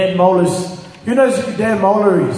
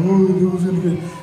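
fast, hammed-up frantic babble; mock panic; faster than usual. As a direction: imitate someone running around in alarm. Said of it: "He runs around and saying".

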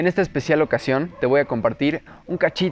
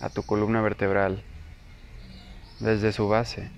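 slow, softer, lower meditation voice with long pauses; guided meditation; 3 semitones lower, 11 dB quieter, slower than usual. Acted. Teacher leading listeners into breath awareness, guided meditation. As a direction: guide the listener unhurriedly through breath and body.

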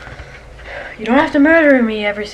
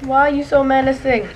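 ordinary talk

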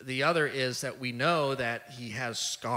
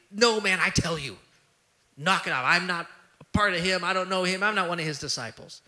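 loud, higher, insistent no; emphatic denial; 6 semitones higher, 6 dB louder than usual. Retold or imitated. Peter denying Jesus to the crowd, loud and emphatic. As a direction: shove the accusation away hard. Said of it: "it's, like, emphatically".

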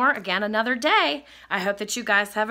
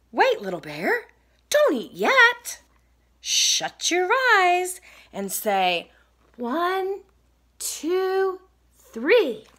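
high, slow, deliberate instructions; high-pitched cat building suspense; urgent storybook cat; 10 semitones higher, 3 dB louder, slower than usual. Acted. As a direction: halt them, then spell out the surprise countdown. Cat halts Little Bear, sets up surprise.

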